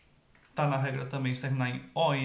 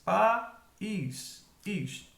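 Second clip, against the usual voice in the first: "país" as pa... ís, with a gap; teacher spacing out "país", pausing between syllables; split into syllables; 5 semitones higher than usual. Shown as a demonstration. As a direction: break "país" into two separate syllables. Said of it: "em sílabas separadas".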